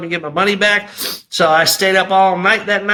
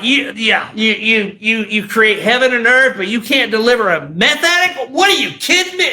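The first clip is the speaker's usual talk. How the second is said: raised, incredulous rant, pitch leaping up; furious retold tirade, stammering into disbelief; yelling at God; 5 semitones higher than usual. Meth addict demanding deliverance from God, angry yelling. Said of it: "I would yell and scream at him".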